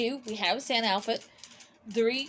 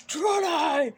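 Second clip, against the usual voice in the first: loud deep voice; 5 semitones higher, 4 dB louder than usual. Retold or imitated. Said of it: "I heard loud, deep voice".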